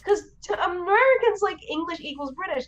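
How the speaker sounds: high, laughing through the explanation; half-laughing aside about Americans. Real, not acted.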